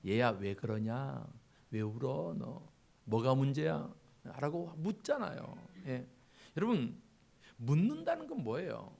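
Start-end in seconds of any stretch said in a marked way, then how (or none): 0.0-3.9 gentle father. Acted. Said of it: "아버지가 와서 뭐라고 해요".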